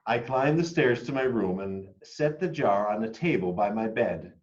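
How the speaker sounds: usual speaking voice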